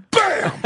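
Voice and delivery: sharp high shouted bam; impact sound effect. Retold or imitated. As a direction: slam it out loud. Crashing into fuselage.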